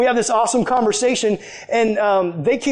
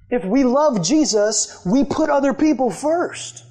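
slow preaching; 5 semitones higher, slower than usual. Real, not acted. Preaching at full force, slow.

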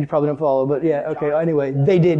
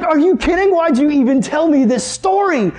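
mock outrage; 9 semitones higher than usual. Retold outrage at friend.

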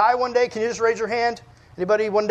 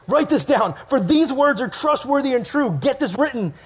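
raised, emphatic command from the throne; urgent proclamation; 4 semitones higher than usual. Acted. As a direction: proclaim it as an order to a scribe. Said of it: "So he's like".

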